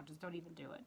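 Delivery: hushed warning under the breath; whispered aside. Real, not acted. Vlogger warns unsteady viewers away, whispering. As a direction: drop to a whisper to warn them off.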